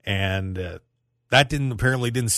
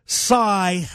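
read-out sigh; 10 semitones higher, 4 dB louder than usual. Shown as a demonstration. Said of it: "here we go".

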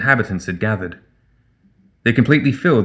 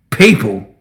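loud, spluttered disbelief; scornful one-word outburst; mock astonishment; 5 dB louder than usual. A narrator's reading. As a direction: splutter it out as if appalled. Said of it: "he spluttered in mock astonishment".

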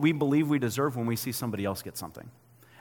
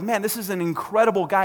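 delighted praise; 6 semitones higher, 4 dB louder than usual. Retold or imitated. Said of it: "They're like".

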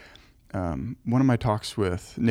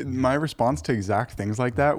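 ordinary delivery

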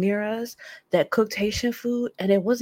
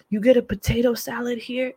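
ordinary delivery

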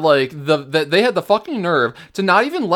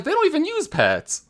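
incredulous; 13 semitones higher than usual. Retold or imitated. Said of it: "and I'm like".

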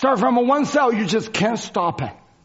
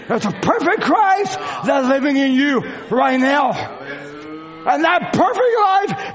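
fiery preaching; 6 semitones higher than usual. Acted. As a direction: preach it out with full force. Preaches at full force.

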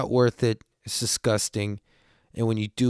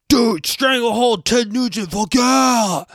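much higher, loud excited shout across the lot; shouting a song request in high spirits; drunken yell; 11 semitones higher, 5 dB louder, slower than usual. Retold or imitated. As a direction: yell it to the driver like a drunk fan. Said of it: "I just yell it to the guy".

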